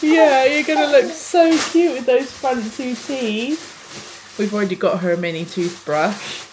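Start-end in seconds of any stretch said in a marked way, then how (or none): 0.0-4.3 higher, louder, slow sing-song gushing. Real, not acted. Mum to teething baby about two front teeth, slow doting coo.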